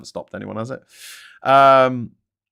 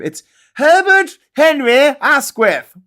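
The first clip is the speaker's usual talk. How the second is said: put-on slow, much higher and louder plummy posh English accent; hammed-up upper-class English accent; 13 semitones higher, 10 dB louder, slower than usual. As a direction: announce your grand name like a pompous English gentleman. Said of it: "You can't even say that in any other accent except a posh one".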